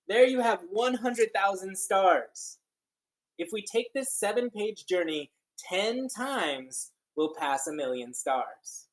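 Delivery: slow, lilting picture-book reading; read-aloud storytelling. Acted. Teacher reading a counting book to children, lilting and slow. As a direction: read it like a wondrous tale to kids.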